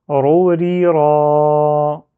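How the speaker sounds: "ro, ri" short, then a long held "ra"; chant-like "ro, ri, ra", loud, stretching only "ra"; dernière syllabe prolongée. Shown as a demonstration. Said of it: "je prolonge". (« ro, ri » brefs puis « ra » prolongé)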